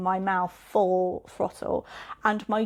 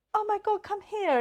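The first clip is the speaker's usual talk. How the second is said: put-on mother scooping up her hurt child, high cooing; comforting mum; 12 semitones higher than usual. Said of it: "my mother would have gone".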